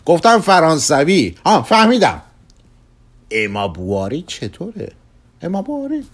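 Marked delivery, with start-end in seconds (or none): 0.0-2.2 high, loud correction turning into realisation; insistent outburst. Acted. Customer insists, then realises. 5.4-6.0 very high questioning echo of the name; astonished echo. Acted. Echoes the suggested name.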